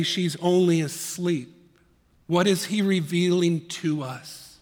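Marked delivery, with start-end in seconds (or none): none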